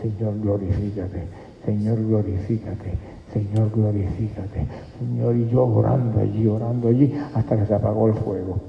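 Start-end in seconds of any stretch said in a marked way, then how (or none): 0.0-5.4 soft, insistent chant of glorifícate; hushed repeated prayer. Retold or imitated. Preacher reenacting his soft prayer at the drum. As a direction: murmur the plea over and over. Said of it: "Y seguí orando".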